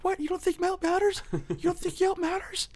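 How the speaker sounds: very high, loud squawk of indignation; mock outrage. Drunk guy outraged at bouncers making fun of him, high mock-angry yelling. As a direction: imitate a mad drunk, voice shooting way up. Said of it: "the guy got so mad".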